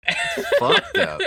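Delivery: high, slow laughing outrage; slow laughing disbelief. Real, not acted.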